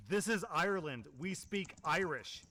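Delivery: loud, high, slowing down at the end; angry shout. Retold or imitated. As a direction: shout it back in fury. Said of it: "shouts in perfect English".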